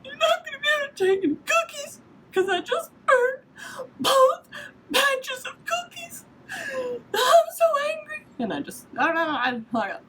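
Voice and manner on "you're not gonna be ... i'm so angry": very high, sobbing, fast then dragging; crying voicemail. Retold or imitated. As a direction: wail the message through tears. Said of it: "I'm like".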